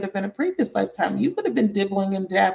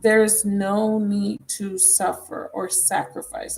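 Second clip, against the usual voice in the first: slow, deliberate reading so each word lands; slower than usual. Shown as a demonstration. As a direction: read it slowly, word by word. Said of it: "I'm gonna read it again slowly".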